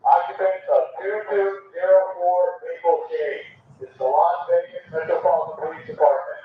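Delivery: normal talking voice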